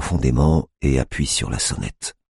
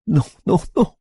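high, louder cries of no; a grieving mother crying out much higher; anguished denial; 3 dB louder than usual. A narrator's reading. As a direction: refuse the news in anguish. Mother hears son is dead.

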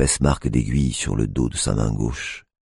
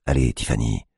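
ordinary delivery, nothing marked

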